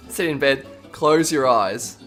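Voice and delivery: usual speaking voice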